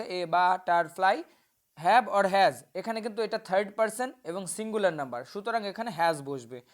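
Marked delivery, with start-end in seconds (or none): 0.0-1.2 butterfly very slowly as ba-tar-fly; broken into syllables. Shown as a demonstration. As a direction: split butterfly into three separate beats. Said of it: "syllable ভাঙা আছে".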